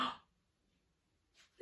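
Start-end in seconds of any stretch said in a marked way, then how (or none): 0.0-0.1 sharp theatrical gasp; surprised gasp. Acted. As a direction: gasp in mock shock.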